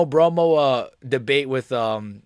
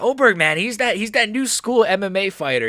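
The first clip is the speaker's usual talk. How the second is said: raised, louder hyped fan voice; mock hype fan; 6 semitones higher, 4 dB louder than usual. Mocking Oberg fans hyping their fighter. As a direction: pump him up like an overexcited fan. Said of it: "it's like".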